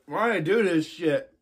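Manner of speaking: strained voice, mouth on fire; talking through the burn; mouth burning from spicy food. Real, not acted.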